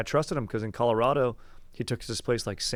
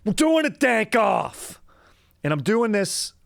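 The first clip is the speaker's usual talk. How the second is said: big, slow showman intro, pitched up high and loud; announcer voice; 8 semitones higher, 7 dB louder, slower than usual. Acted. Host tells listeners it's a dank off, booming announcer voice. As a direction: hype the crowd like a game show host. Announcing a special podcast episode.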